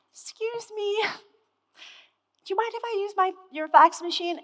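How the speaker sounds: very high, timid and sweet, slow on the middle; high-pitched and sweet. Retold or imitated. Young rep asking the partner for the fax, high and sweet. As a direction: squeak it out like a shy new hire afraid to intrude. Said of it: "I was really high-pitched and sweet".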